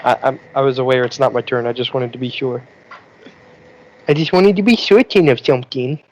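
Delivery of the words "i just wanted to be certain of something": higher, playful put-on voice. Player restating why they spoke out of turn, put-on voice. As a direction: repeat it in a higher, silly voice. Repeats own line in put-on voice.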